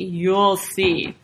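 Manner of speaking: drawn-out, ominous repeat; knowing, mysterious storybook guard. A narrator's reading. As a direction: stretch it out like a veiled threat. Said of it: "replied the guard mysteriously".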